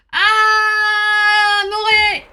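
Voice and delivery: sudden loud high cry of alarm; a real startled yell; shout. Real, not acted. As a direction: yell the name out in panic.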